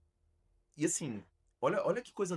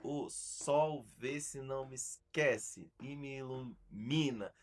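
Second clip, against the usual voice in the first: slow, measured "o sol vê se não me esquece e me ilumina", leaning on each strong syllable; stressed syllables on a beat; much slower than usual. Shown as a demonstration. Teacher showing how a lyric lands on the metronome, slow stressed syllables. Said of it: "a sílaba forte da palavra".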